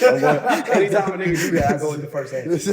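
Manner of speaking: excited teasing. Real, not acted. Teasing, laughing.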